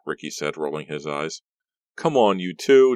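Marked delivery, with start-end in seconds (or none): 2.0-3.0 higher, louder, slow plea; pleading. A narrator's reading. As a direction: plead with both to stop. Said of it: "Della pleaded".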